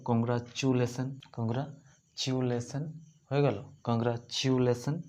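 Congratulation said slowly, every syllable clear; slow clear demo. Shown as a demonstration. Teacher revealing the finished word slowly to students. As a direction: draw the word out for young learners. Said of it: "এইভাবে মজাদার".

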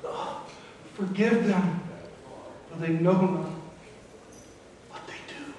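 a man on the cross gasping out his last words; strained, dying breath. Shown as a demonstration. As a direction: squeeze each word out with no air left. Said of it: "he has to strain to talk".

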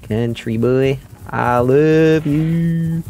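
high, slow drawn-out country croon; hammed-up country love call; country twang. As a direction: stretch it out like a lovesick cowboy. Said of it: "I know you're a country boy".

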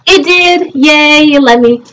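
high happy relief; excited. Real, not acted. Game finally let him exit.

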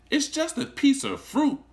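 lightly voiced schoolgirl scoffing; protesting girl. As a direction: brush it off like a jealous classmate. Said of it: "Sarah protested".

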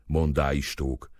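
ordinary delivery, nothing marked

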